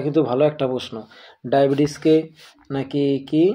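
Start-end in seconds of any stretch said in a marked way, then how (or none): none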